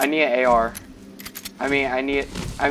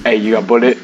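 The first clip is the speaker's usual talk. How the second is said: loud goofy voice; 6 semitones lower, 10 dB louder than usual. Pretend. Loud repeat of beggar bit.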